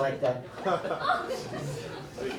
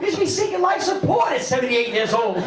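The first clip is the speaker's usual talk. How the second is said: mock shout; 8 semitones higher than usual. Comic to the crowd about the old lady, mock shout. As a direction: bark the punchline at them.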